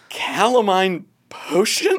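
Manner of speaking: slow, puzzled, rising question; baffled repeat. Retold or imitated. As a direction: repeat it in disbelief. Hypnotist stunned, repeating name.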